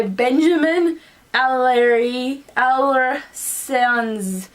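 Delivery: very slow, stretched-out syllables; struggling to pronounce. Real, not acted. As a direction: feel your way through a difficult name. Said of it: "Your name's hard to pronounce".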